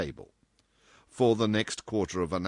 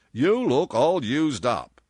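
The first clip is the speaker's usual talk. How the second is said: loud, slow, higher voice; insistent boss; 6 semitones higher, 8 dB louder, slower than usual. A narrator's reading. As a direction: state it bluntly and slowly.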